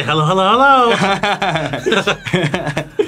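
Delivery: mock [name] greeting. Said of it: "She don't even say".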